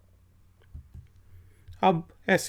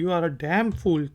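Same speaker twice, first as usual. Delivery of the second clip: You are a damn fool said slowly and evenly; slow, clear example sentence; much slower than usual. Shown as a demonstration. Teacher reads the example slowly for students. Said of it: "जिसे हम कहते हैं".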